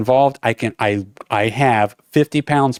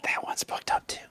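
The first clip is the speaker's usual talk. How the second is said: barely audible whispered conferring; acted whisper; 18 dB quieter than usual. Desk agents checking full flights together, whispering. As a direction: imitate whispering to a colleague.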